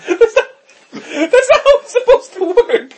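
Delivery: real laughter breaking the words; laughing protest. Real, not acted. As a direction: squeal it out in disbelief. Laughing protest about burnt toast.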